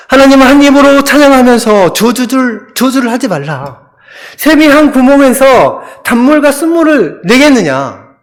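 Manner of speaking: ordinary talk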